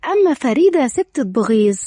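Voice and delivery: usual speaking voice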